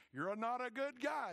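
accusing friends. Retold or imitated. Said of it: "they're gonna say".

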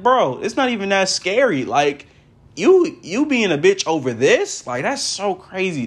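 high-pitched disbelieving ribbing; incredulous scolding. Retold or imitated. Fan scolding a scared cartoon character, incredulous.

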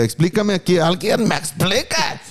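high, drawn-out plea for an explanation; hammed-up exasperation, slow and high; exasperado. Exasperado pide una explicación. (súplica exagerada, aguda y lenta)

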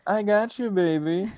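high, lightly put-on catchphrase voice; Austin Powers catchphrase. Podcaster imitating a man's video catchphrase, lightly put-on high voice. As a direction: toss it out as a high-pitched catchphrase. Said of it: "He loves Austin Powers".

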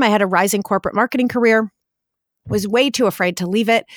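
ordinary talk, nothing marked

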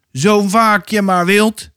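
narrated defiant yell; shouting. A narrator's reading. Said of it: "schreeuwde Nino".

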